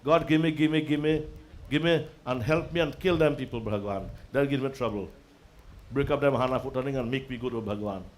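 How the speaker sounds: low, muttered parody of begging God; mock petitioning prayer. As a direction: imitate a selfish devotee's nagging plea. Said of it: "What you'll tell God".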